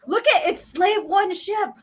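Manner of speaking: high-pitched mock amazement; hammed-up excited lookout; acted alarm. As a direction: exclaim at a sudden sighting. Acted sighting of enemy ship.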